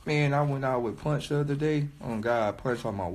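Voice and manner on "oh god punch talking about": ordinary delivery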